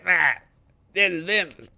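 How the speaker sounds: high, loud pitchman voice imitating Vince; Vince pitchman impression. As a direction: ham up the TV salesman Vince. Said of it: "Didn't he talk like this?".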